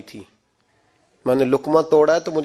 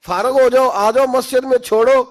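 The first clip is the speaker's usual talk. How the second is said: higher and louder, pleading with the elders to let go; raised urging call; 8 semitones higher, 4 dB louder than usual. Real, not acted. Preacher urging elders to leave wealth, raised voice. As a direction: call them to the mosque, loud.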